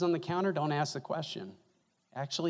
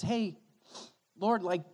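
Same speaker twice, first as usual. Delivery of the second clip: higher, louder, pleading Son to the Father; Jesus pleading earnestly with the Father, higher and louder; 5 semitones higher, 7 dB louder than usual. Retold or imitated. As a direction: beg the Father to let him help. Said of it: "and Jesus says".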